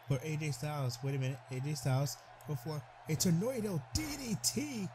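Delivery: usual speaking voice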